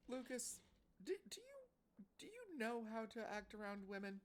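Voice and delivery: high, stammering disbelief; a hint of a shocked tailor; incredulous tailor. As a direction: tailor can't believe what he sees. Tailor scolding Lucas in character.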